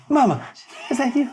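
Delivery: put-on high, hopeful child voice; orphan child. Orphan calling for a lost mother, high child voice.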